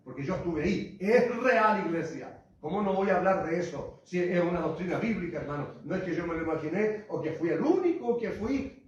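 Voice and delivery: loud, insistent preaching; preaching at full force. Acted. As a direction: hammer the point home. Insists hell is real. (predicación enérgica y fuerte)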